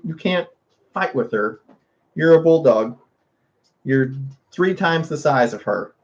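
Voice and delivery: ordinary talk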